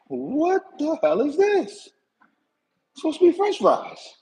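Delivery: can't believe what's on the plate; outraged disbelief. Retold or imitated. Opening the box of sliced sweet potatoes, high disbelieving outrage. Retold reaction to wrong fries.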